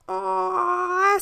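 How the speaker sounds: put-on little boys cooing at the puppies. A narrator's reading. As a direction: coo like a charmed kid. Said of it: "said the little boys".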